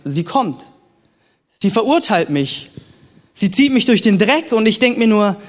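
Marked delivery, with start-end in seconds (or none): none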